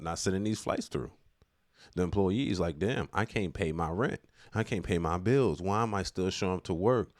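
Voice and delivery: ordinary talk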